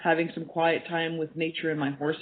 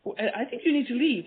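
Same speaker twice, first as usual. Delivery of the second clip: higher, mock-indignant challenge; indignant boss; 8 semitones higher than usual. Retelling Doctor Galdikas telling her to leave, mock indignant. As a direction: retell her sharp dismissal, pitched up. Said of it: "She says".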